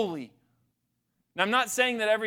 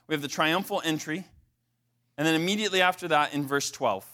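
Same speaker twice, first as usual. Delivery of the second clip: usual speaking voice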